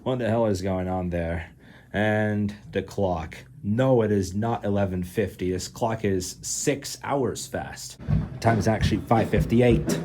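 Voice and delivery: normal talking voice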